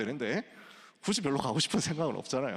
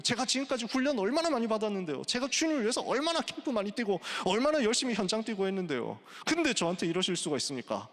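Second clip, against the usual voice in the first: high, mock-aggrieved complaint; sulky protest; 7 semitones higher than usual. Elijah complaining to God about his hard work, sulky and high-pitched. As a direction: act out a sulky servant pleading his case. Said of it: "막 삐져가지고 이러는 겁니다".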